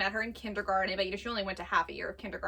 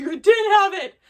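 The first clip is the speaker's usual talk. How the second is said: put-on high, much louder accusing child; angry child; 9 semitones higher, 12 dB louder than usual. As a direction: shout it like a furious little boy. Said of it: "He's like".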